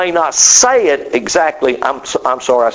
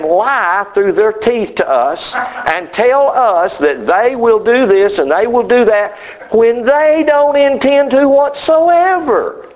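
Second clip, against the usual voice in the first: fired-up preaching; 6 semitones higher than usual. Real, not acted. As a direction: build the outrage question by question. Preaching against lying leaders.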